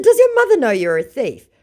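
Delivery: high, loud scornful question; sneering high jab; mocking taunt. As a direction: shame him with sarcasm. Taunting scammer.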